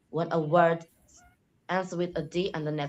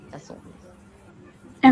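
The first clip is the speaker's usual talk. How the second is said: usual speaking voice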